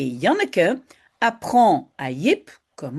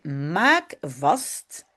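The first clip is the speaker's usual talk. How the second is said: maak vast drawn out slowly and clearly; slow teaching demo; 7 semitones lower than usual. Shown as a demonstration. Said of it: "Et où est le verbe attacher?". (maak vast prononcé lentement, bien détaché)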